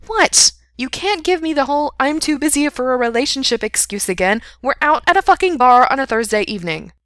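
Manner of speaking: high, loud, scolding woman friend; exasperated friend. A narrator's reading. Friend dismisses his excuse at the bar, exasperated. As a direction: pitch it up and scold him loudly.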